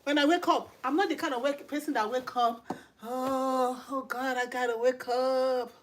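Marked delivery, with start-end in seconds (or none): 3.0-5.7 slow, droning groan of someone dragging out of bed; hammed-up weary morning moan; mock sluggish waking. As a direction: drag out every word as a reluctant riser. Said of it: "No, I don't wake up like that".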